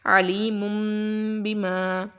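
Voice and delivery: chanted tajweed recitation with a nasal M; Quran recitation. Shown as a demonstration. Teacher recites the example for the students. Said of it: "نون ساکن کی آواز کو ہم میم سے بدل دیں گے".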